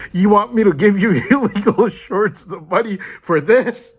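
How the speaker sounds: high, loud, laughing disbelief at the request; incredulous mock quote. Mocking the father's demand for money, incredulous and laughing.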